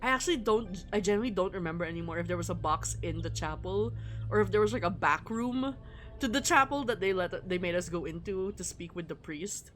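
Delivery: usual speaking voice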